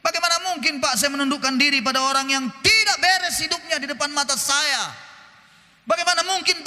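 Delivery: hammed-up whining objector; mocking complainer. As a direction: parody a grumbling churchgoer's excuse. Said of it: "Dibilang begini".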